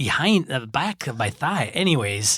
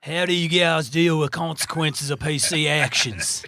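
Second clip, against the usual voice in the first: mock Australian accent, a drunk farmer more than an Aussie; bad Australian accent. Reading a listener question to cohost in a bad Australian accent. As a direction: read it in a sloppy fake Australian accent. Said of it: "You just sound like a drunk farmer".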